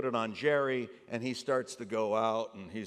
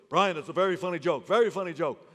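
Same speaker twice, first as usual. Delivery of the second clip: Larry David; 3 semitones higher, 5 dB louder, faster than usual. Retold or imitated. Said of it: "Larry David goes".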